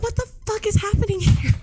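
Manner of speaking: high, loud exasperated shout; shrill, loud mock fury; mock outraged yell. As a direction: yell it at the room, half-joking. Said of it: "'cause I was like".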